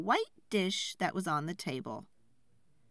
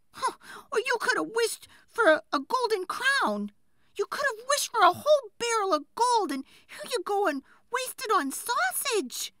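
high, loud, nagging wife berating him; scolding wife; 6 dB louder than usual. Acted. Wife scolding the woodcutter for wishing for sausage, high loud nag. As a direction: pile on the blame, voice up high.

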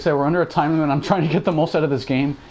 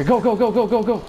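higher-pitched, rapid-fire go-go-go; frantic urging; 5 semitones higher than usual. Real, not acted. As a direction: urge them to run in a panic. Urging character to flee from zombies.

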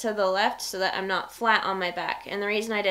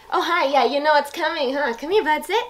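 excited dog talk; 6 semitones higher, 6 dB louder than usual. Real, not acted.

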